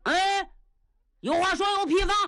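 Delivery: high, cheeky soldier's bark; brash Niu Gao voice. Acted. Brash Niu Gao answering the marshal rudely, high and cheeky. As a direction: pitch it up and talk back rough to the marshal.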